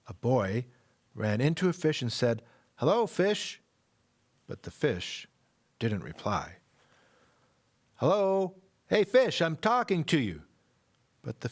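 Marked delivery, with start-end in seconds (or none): 2.8-3.6 put-on cheerful boy. Boy greets a fish. 8.0-10.5 put-on boy calling out to a fish that won't answer. Boy calls to silent fish.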